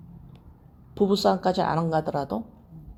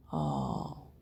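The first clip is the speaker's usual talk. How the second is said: usual speaking voice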